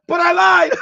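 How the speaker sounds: loud and high, breaking into laughter; giving up on holding the laugh in; bursting laugh. Real, not acted. Admitting to viewers he can't stop laughing, loud laugh. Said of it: "I said I wasn't gonna laugh".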